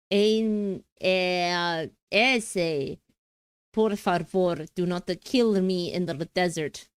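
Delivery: badly faked Mexican accent drifting into Slavic; Slavic-tinged Mexican accent. Streamer pleading as a drama character, mock Slavic-Mexican accent. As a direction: attempt Mexican, land halfway to Slavic. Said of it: "starting to sound slightly Slavic".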